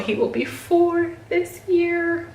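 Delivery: real emotion catching in the throat; getting emotional. Real, not acted. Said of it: "I'm getting emotional about it".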